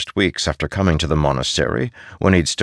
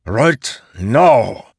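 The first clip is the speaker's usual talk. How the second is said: raised, loud command; forceful order; 6 semitones higher, 5 dB louder than usual. Acted. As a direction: demand instant obedience. Posen enforces the order.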